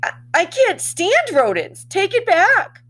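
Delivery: high, loud, horrified outburst; teacher shrieks in horror at the hamster. Acted. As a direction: recoil from the rodent in disgust. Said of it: "looked horrified and said".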